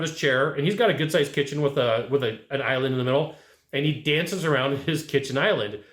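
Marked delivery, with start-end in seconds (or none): none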